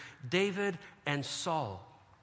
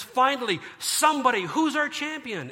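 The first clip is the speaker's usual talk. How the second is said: eager king; 6 semitones higher, 6 dB louder than usual. Retold or imitated. Relieved king calls out.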